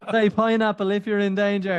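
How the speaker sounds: high, loud put-on voice; joking call handler voice, high and loud; mock operator. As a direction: ham up the hostage-line operator.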